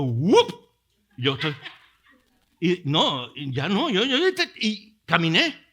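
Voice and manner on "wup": loud "wup" of being yanked up; imitated sudden pull; sudden whoosh noise. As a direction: punch out a quick whoop. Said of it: "de pronto".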